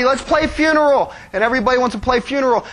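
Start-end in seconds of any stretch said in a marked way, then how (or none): 0.0-1.1 lightly voiced eager kid, higher; a kid suggesting a game; a hint of an excited child. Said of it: "like, somebody".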